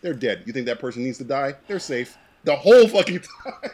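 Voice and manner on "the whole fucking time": loud, driven emphasis; emphatic. Real, not acted. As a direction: hammer the point loudly.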